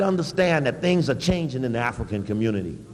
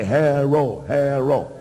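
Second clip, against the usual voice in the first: lightly voiced calling out the name while tossing hams; mock campaign call; 2 semitones lower, much slower than usual. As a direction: mimic a politician calling out to the crowd. Throwing them down and say.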